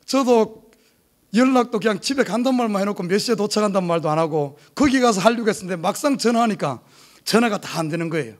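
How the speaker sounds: normal talking voice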